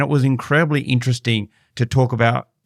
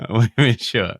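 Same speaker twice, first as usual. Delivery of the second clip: laughing mock French. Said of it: "your French is still pretty rubbish".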